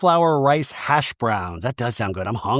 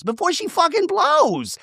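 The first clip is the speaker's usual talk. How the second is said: much higher, squeaky mock voice; hammed-up mimicry of Gina; mocking squeak. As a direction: parrot it back in a silly high voice. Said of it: "Gina says".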